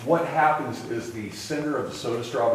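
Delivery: normal talking voice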